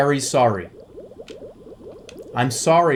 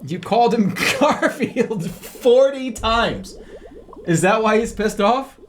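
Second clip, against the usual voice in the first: laughing tease; 7 semitones higher than usual. Real, not acted. Teasing about repeated name.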